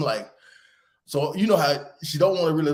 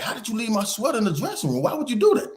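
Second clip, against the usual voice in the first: high-pitched, rapid-fire indignant questioning; retold exasperated telling-off, rising higher; scolding dad; 10 semitones higher, faster than usual. Dad demands why his daughter left the sweater, scolding.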